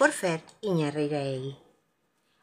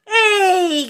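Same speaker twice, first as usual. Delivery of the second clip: high, loud, drawn-out "hey"; protesting shout; 13 semitones higher, 10 dB louder than usual. A narrator's reading. Rito as the block tower falls, shouting. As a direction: yell out in protest. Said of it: "gritó Rito".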